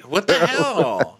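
high, slow disbelieving what-the-hell; mock outrage. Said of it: "Lena's like".

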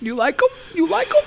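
high mocking squeak; mock it in a squeaky voice; mocking squeal. Mocking the video.

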